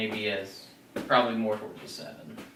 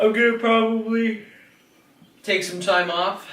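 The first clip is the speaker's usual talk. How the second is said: goofy loud voice; 11 semitones higher, 8 dB louder than usual. Pretend. Sudden loud put-on voice.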